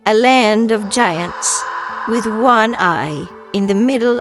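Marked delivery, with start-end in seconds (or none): none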